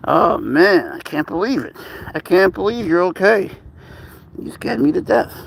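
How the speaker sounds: high, gushing relief; overjoyed relief. Real, not acted. To a baby animal found okay, overjoyed relief.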